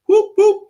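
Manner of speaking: sudden squeaky falsetto whoop; a quick high whoop for the temper popping. Retold or imitated. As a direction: yelp it like something snapping. Needle pops, temper flares.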